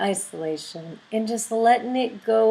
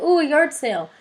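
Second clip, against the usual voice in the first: delighted discovery; 7 semitones higher, 5 dB louder than usual. Retold or imitated. Spotting a yard sale.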